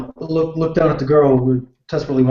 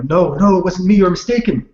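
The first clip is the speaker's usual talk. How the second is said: raised, higher protest of innocence; mild yell; 7 semitones higher, 4 dB louder than usual. Acted. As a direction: call out to deny it, a bit louder. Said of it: "attempt to at least yell".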